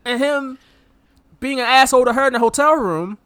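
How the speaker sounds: indignant. Real, not acted. To co-host about Rip's behaviour, indignant protest. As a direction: list the wrongs indignantly.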